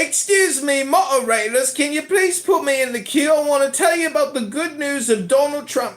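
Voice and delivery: high put-on character voice; winner voice. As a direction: pipe up in a high, silly voice. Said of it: "I think I found a winner voice".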